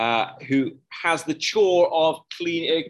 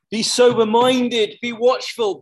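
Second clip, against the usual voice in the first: loud, higher, slow and emphatic; preaching shout; 8 semitones higher, 5 dB louder than usual. Real, not acted. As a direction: hammer each command home to the congregation. Preacher urges congregation, repeats later plainly.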